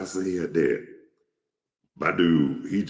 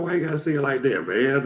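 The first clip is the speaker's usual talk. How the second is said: whiny complaint; 10 semitones higher, faster than usual. Retold or imitated. Caller complaining to the man.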